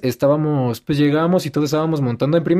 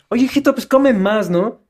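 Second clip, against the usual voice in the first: mock nagging lady; 8 semitones higher than usual. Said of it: "es como de".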